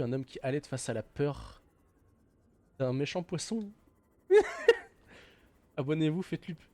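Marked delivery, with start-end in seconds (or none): 4.3-4.8 sudden squealing laugh; a shrill yelp of laughter; real surprised laugh; high laugh burst. Real, not acted.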